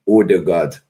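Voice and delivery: Odegaard said the way Norwegians say it; "Odegaard" carefully pronounced, imitating native speakers; proper Norwegian pronunciation. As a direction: imitate the Norwegians' "Odegaard", with a short clean first vowel. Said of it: "pronounced his name properly".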